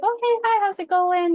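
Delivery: high, bright-eyed greeting to a stranger; bubbly small talk. Retold or imitated. Greeting a stranger at the psychic fair, chirpy and high. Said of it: "we were just like".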